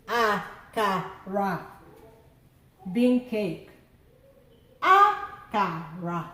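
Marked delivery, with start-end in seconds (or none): 0.1-1.6 Akara stretched out slowly; teacher repeating Akara; slow Igbo word. Shown as a demonstration. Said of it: "let's do the tone marks together". 4.8-6.3 Akara said slowly, pitched low; teacher repeating Akara; slow Igbo word. Shown as a demonstration. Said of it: "let's do the tone marks together".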